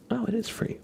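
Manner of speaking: ordinary delivery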